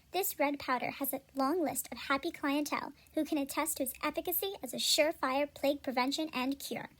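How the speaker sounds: normal talking voice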